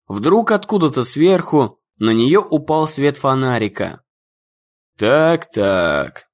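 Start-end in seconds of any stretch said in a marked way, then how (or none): 5.0-6.3 lightly voiced menacing man; gruff man's voice. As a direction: lower man's voice, smug. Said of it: "сказал мужской голос".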